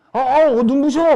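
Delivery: loud, high, gushing exclamation; mock dazzled flattery. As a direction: act blinded by the customer's beauty. Acts dazzled salesperson flattering customer. (눈부신 척 호들갑스럽게)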